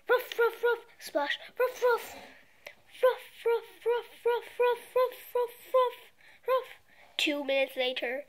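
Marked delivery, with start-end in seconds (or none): 0.1-6.7 high barking; dog barking. Acted. Dog barking at the doorbell, playful. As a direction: bark like an excited puppy.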